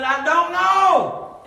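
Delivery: high, loud, slow and laughing; laughing shout. Retold or imitated. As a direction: blurt it through a laugh. Comic punchline, laughing shout.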